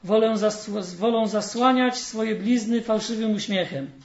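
fairy tale reading. Acted. Preacher reading a fairy tale to the congregation, storytelling. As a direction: read the tale warmly to the congregation.

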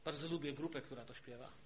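ordinary talk, nothing marked

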